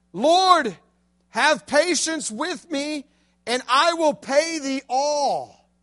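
lightly voiced begging servant. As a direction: plead on your knees for mercy. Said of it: "Just begging for forgiveness".

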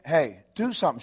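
ordinary talk

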